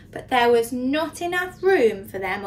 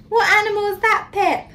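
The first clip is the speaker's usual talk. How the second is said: ordinary delivery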